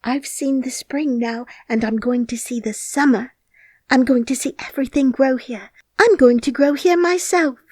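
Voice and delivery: rising, growing excitement; excited boy. A narrator's reading. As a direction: build up to an eager cry. Colin's excited hope.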